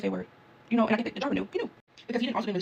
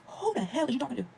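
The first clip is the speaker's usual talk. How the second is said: raised-pitch affronted challenge; indignant comeback; 5 semitones higher, slower than usual. Acted. Traveler reacting to the lady rushing her, indignant. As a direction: act offended at being ordered about.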